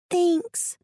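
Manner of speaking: put-on high child voice, a bit weary; little girl Alice. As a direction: speak as a young girl telling her story.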